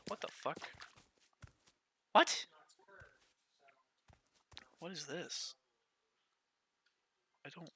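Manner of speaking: hushed whisper of shock; whispered disbelief. Real, not acted. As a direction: breathe it out in disbelief. Quiet disbelief.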